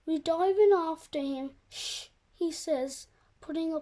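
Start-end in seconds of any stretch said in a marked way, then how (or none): 1.7-2.1 soft hushing shh; shushing. Acted. Rabbit hushing the kids in the barrel, soft shh. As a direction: hush them, finger to lips.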